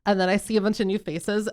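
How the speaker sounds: ordinary talk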